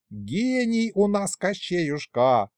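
high, drawn-out fawning praise; butter him up, stretching the praise; flattering. A narrator's reading. Grandpa sucking up to the tsar, flattering. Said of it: "льстиво поддакнул дед".

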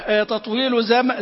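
usual speaking voice

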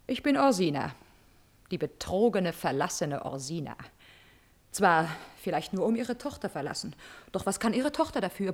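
leiser, tiefer, bitterer Ton; bitter leise. Acted. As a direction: sprich die Kränkung leise aus. Verlassene Gräfin klagt leise. (leise und bitter klagend)

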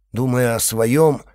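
usual speaking voice

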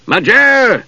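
high angry yell; angry shout. A narrator's reading. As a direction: bellow the name in rage. Knight yelling at shut door.

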